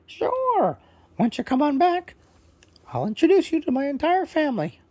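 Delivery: high, loud squawky parrot; proud parrot introducing himself, high and loud. Acted. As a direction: chirp it out as a cheerful parrot bird. Parrot invites them back.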